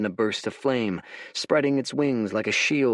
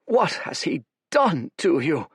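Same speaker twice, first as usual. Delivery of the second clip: trembling; 6 semitones higher than usual. Acted.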